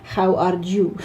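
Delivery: put-on Spanish accent with a harsh throaty J for the H; hello with a rasping Spanish J sound. Teacher imitating a Spanish child's Spanish accent on hello. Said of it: "pronounce it like a J".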